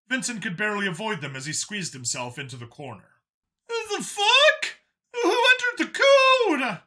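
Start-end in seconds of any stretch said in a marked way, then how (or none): 3.7-6.8 very high, loud alien shout; alarmed Howler guard. A narrator's reading. Howler guard barging into the lift, loud alarm. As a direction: bellow at the intruder.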